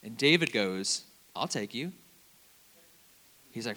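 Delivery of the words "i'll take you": softer, casual retold offer; understated offer. David first offers the freshman a ride, understated. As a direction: offer it quietly, pitch near usual.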